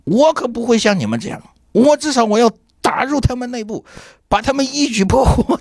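high, grand movie-hero declaration; mock heroic boast. Director boasts he'd infiltrate the counterfeiters, high hammed-up heroic vow. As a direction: play him like a film detective vowing to crack the case, hammed-up. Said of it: "他就说".

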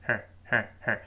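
brief, unstressed "her"; "her" reduced and passed over quickly; weak form of "her". Shown as a demonstration. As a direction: skim lightly over "her". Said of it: "就是一带而过".

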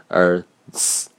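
ts said as an English ts cluster. Shown as a demonstration. Teacher showing students the English ts, English-style ts. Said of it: "英文中的ts".

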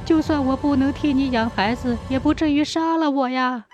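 high, wailing plea in a crying voice; tearful pleading. A narrator's reading. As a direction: plead for your life through tears. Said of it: "媳妇儿哭着说".

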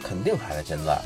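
usual speaking voice

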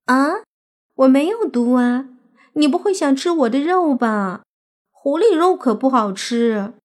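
put-on high, loud girlish protest; alarmed fox-demon girl. Fox girl fears he wants to eat her, high and alarmed. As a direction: protest innocently as a startled little fox spirit.

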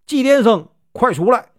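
storyteller's understated angry shout; calling out. A narrator's reading. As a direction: summon someone out to face you. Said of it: "就喊道".

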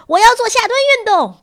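very high, loud yell; high-voiced shout. A narrator's reading. Pupil demanding squats from tired teacher, shouted high. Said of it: "布鲁诺高声叫道".

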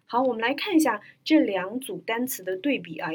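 ordinary talk

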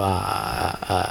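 very quiet, drawn-out blank "uh"; spaced out. Retold or imitated. Lost driver on the phone to a friend, spaced out. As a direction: trail off low, mind gone empty. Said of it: "放空了".

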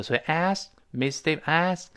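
"ask" slow with the k held back and not released before "Miss"; unreleased k. Shown as a demonstration. Teacher demonstrates the swallowed k in ask, slowly. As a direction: hold the k of "ask" silent, slowly. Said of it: "这个k在这失去爆破了".

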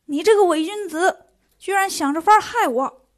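loud, high accusing shout; angry yelling. A narrator's reading. Monkey King shouts at the bodhisattva, loud and accusing. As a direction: yell it right in her face. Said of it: "可就嚷嚷开了".

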